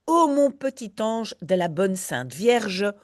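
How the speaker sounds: usual speaking voice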